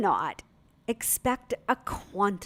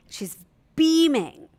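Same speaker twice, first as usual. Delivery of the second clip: normal talking voice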